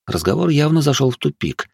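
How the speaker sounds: ordinary delivery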